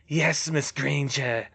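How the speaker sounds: slow, smug villain voice; self-satisfied dark lord, unhurried. A narrator's reading. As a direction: draw it out, pleased with yourself. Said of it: "You-Know-Who said smugly".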